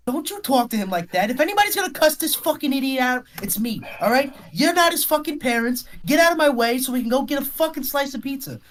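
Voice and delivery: high, fast New York cussing; New York accent. Acted. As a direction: shove him back with a New York snarl. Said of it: "New York accent".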